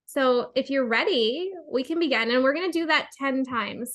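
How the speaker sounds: usual speaking voice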